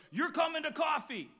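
retold friend shouting an order; mock insistent shout. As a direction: bark it like a friend who won't take no.

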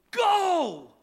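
loud high shout from the third base coach; coach yelling go. Retold or imitated. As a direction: yell it across the field to the runner. Left fielder yelling go.

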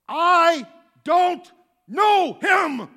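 very loud, high and dragged-out shout of I don't know him; furious denial. Acted. Peter cursing at the crowd, slow furious shout. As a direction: scream the denial in anger. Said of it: "Peter now is like".

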